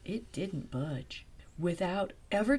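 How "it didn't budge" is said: slow hushed whisper. A narrator's reading. Narrator whispering to the child.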